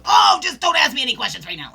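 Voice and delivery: loud, curt brush-off; snappy stressed tone. Retold or imitated. Stressed person brushing off questions, loud and curt. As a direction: snap it out like you're overwhelmed. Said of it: "We use that tone".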